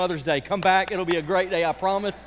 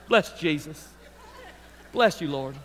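lower, mock-devout church murmur; pious murmur; 5 semitones lower than usual. Churchgoer putting on piety after a bad morning, lower. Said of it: "and you go".

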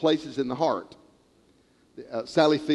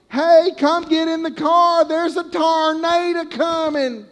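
calling out; 11 semitones higher, 3 dB louder, slower than usual. Retold or imitated. Family in car calling out.

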